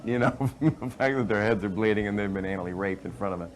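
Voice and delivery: usual speaking voice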